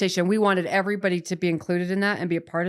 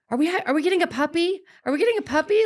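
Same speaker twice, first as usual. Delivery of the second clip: put-on much higher, puzzled child's question; confused kids; 10 semitones higher, faster than usual. Mother imitating her kids' confused puppy guess. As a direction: mimic the kids asking, baffled. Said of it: "they were so confused".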